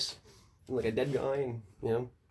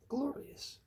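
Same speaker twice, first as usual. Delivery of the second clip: high awed; 12 semitones higher, 5 dB quieter than usual. Real, not acted. Repeated in awe.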